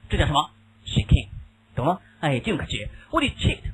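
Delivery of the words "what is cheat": high sudden what is cheat; teacher quizzing sharply; high quiz question. Shown as a demonstration. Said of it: "quiz question".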